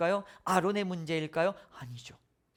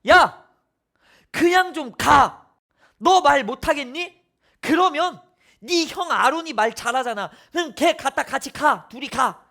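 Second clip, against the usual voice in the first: loud, higher, exasperated scolding; God losing patience with Moses, loud and exasperated; angry God; 5 semitones higher, 9 dB louder than usual. Acted. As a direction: snap at Moses in loud annoyance. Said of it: "화를 내시면서 뭐라고 말씀하시느냐?".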